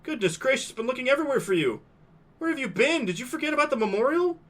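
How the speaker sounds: high-pitched fussy principal; a school principal scolding a lost student; flustered principal. Acted.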